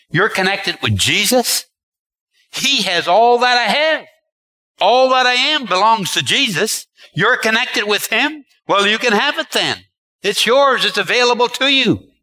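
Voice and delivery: high-pitched, insistent voice of the Father; God the Father responding. Acted. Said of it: "and the Father hears".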